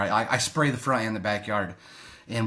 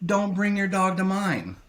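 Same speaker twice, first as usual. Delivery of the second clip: high-pitched, indignant rant held short of screaming; retold silent scream at a neighbour, controlled rage; mock inner yelling; 6 semitones higher than usual. Non-confrontational man imagining telling off a dog walker, mock yelling. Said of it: "I'm screaming like".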